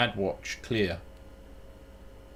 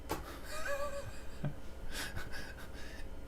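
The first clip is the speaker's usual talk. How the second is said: long, soft high wheeze of laughter; wheezing laugh; 11 dB quieter than usual. Real, not acted. Streamer cracking up with his viewer, wheezing with laughter. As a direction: dissolve into quiet squeaky laughter. Amused during game chat.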